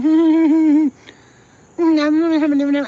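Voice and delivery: high, loud closed-mouth humming; tight-lipped hums to hide the teeth; hiding teeth hum. Shown as a demonstration. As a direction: hum through a fake smile, mouth shut. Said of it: "I'm gonna talk like this".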